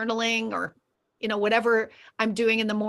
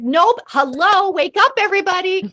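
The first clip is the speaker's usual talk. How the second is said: mock wake-up call; 8 semitones higher, 7 dB louder than usual. Coach waking up a sleepy class, mock loud call. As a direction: rouse the group with a mock shout. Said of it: "And then she's like".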